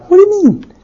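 high-pitched incredulous question; exasperated bafflement. Acted. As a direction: throw up the pitch in disbelief. Husband baffled in acted quarrel.

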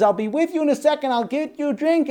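high, loud, rushed promise; harried mother. Acted. Overwhelmed mother putting off thirsty child, harried.

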